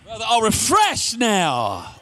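slow hyped wrestler voice; wrestler drawl. Shown as a demonstration. Still in wrestler mode, slow and hyped. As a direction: stretch it out like a wrestling promo. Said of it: "I feel like Ric Flair".

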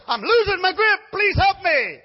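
high, loud desperate begging; man on the cliff begs the Lord; frantic plea. Acted. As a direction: beg as your hands slip. Man slipping pleads.

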